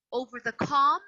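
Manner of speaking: ordinary talk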